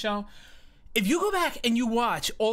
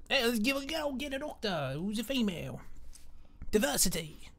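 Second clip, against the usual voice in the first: badly faked British accent, softly delivered; mock a Brit in a hushed, bad accent; horrible British accent; 7 dB quieter than usual. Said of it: "Sorry for my horrible British accent".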